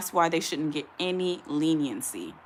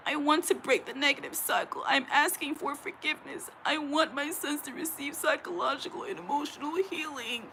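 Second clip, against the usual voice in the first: mock crying abuser; 8 semitones higher, 5 dB quieter than usual. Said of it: "that's fake tears".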